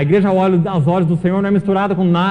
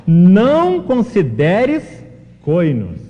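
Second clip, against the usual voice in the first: slow, louder, weighty rebuke; solemn command; 5 dB louder, much slower than usual. Retold or imitated. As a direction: lay down the Lord's correction slowly. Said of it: "Ele fala". (ordem solene, lenta e mais forte)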